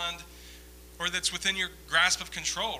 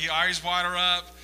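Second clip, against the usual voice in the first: normal talking voice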